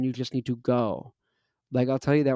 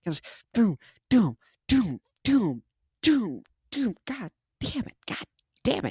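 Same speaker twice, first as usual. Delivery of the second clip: much higher, strained mock lifting voice; straining under weight; 8 semitones higher than usual. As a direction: squeeze it out mid-rep. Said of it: "you say".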